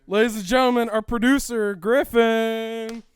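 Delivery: announcer voice. Pretend. Formal introduction of producer.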